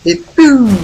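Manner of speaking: very high, loud poum; playful sound effect. Acted. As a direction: make the throw go poum. Throwing pebble in game.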